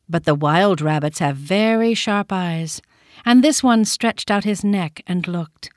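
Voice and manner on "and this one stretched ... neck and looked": usual speaking voice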